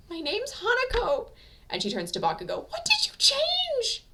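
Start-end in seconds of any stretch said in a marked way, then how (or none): 0.1-1.3 tearful woman. A narrator's reading. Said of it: "She's crying now". 2.7-4.0 high, slow, tearful woman's question; tearful demand. A narrator's reading. Hanako crying while demanding of Bakugo, high and slow. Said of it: "She's crying now".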